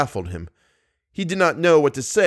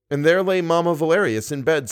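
normal talking voice

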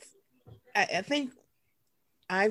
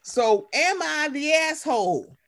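segment announcer voice; 10 semitones higher, 4 dB louder, slower than usual. Acted.

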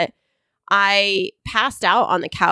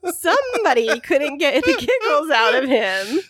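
laughing; 4 semitones higher than usual. Real, not acted. Laughing fit.

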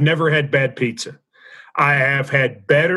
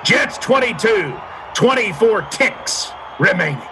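excited score call; 7 semitones higher than usual. Pretend. Score update after the call.

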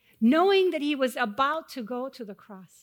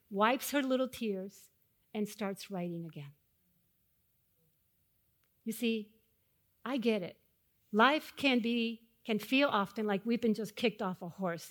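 ordinary talk

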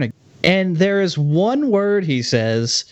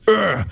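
short imitated dinosaur growl; raptor grunt; 3 semitones higher than usual. Podcaster mimicking the raptors' one sound, raptor grunt. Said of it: "fucking raptors make one sound".

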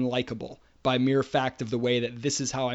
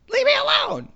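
very high-pitched, louder mock yell; mimicking son's yell; 5 dB louder than usual. Father mimics his son snapping at him, high mock yell. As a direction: imitate a snappy kid yelling in a squeaky pitch. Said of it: "and I'll mimic him".

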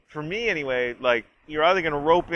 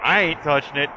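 nervous refusal; 4 semitones higher, 4 dB louder than usual. Retold or imitated. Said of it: "Big E's just like".

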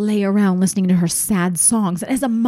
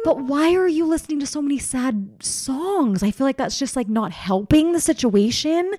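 pleading mom voice; 6 semitones higher than usual. Retold or imitated. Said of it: "And I'm like".

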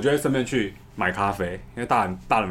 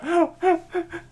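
high giggle. Real, not acted. Laughing at niece's trick.

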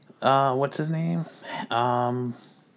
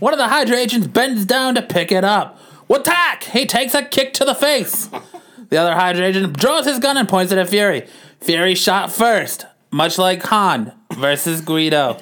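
raised, dramatic newsreel read; old-timey narrator; 6 semitones higher than usual. A narrator's reading. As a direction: keep the old newsreel announcer going. Narrates the comic.

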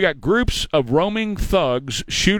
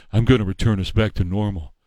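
put-on lower, mumbling old-man take on Biden; lower, mumbled Biden parody; mocking Joe Biden; 7 semitones lower than usual. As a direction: drop the voice low and mock the president. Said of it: "this was the president".

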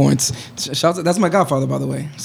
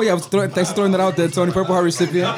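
ordinary delivery

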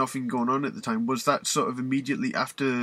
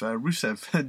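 ordinary talk

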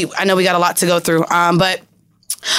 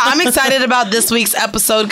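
ordinary talk, nothing marked

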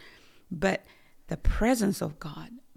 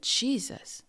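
fearful call; 5 semitones higher than usual. Retold or imitated. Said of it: "I had so much fear".

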